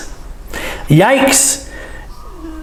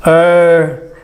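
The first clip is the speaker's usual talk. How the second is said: usual speaking voice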